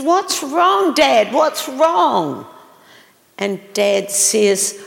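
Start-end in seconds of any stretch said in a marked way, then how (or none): 0.0-2.4 put-on higher, louder voice of a worried child; child's concerned question. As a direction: ask like a little kid checking on Dad. Child asks grieving father.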